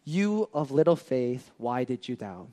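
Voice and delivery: soft, quiet and kind; soft fading reproach; gentle rebuke. Retold or imitated. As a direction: rebuke him tenderly, almost whispering. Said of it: "And he said to him".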